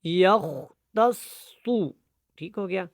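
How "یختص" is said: slow, deliberate یختص with heavy s; thick saad. Shown as a demonstration. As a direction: hold a full, rounded s in یختص. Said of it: "سواد موٹا ہے".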